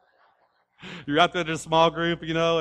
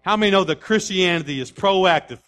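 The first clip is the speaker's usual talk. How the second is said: raised, driving sermon delivery; emphatic preaching; 5 semitones higher, 6 dB louder than usual. Real, not acted. Preacher telling congregation Christianity is proactive, forceful preaching. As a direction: drive the point home to the crowd.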